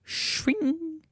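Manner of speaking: high, soft "shwing"; imitated blade swish. Player miming Corvo's stab, vocal blade swish. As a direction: make the blade sound with your voice. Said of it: "us acting as Corvo were like".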